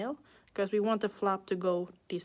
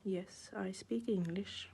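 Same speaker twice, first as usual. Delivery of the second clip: whispered; 7 dB quieter than usual. Real, not acted. Joking whispered aside.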